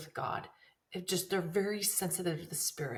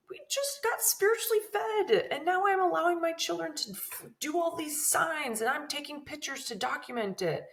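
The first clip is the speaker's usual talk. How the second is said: inner self-reproach; 10 semitones higher, 3 dB louder than usual. Retold or imitated. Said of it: "I was thinking".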